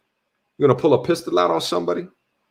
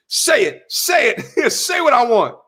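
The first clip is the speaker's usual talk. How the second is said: higher, comic demanding voice, a touch of laughter; mock hostage-taker demand; 7 semitones higher than usual. Comic gunman holding it hostage, demanding in a higher voice.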